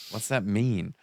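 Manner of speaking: ordinary talk, nothing marked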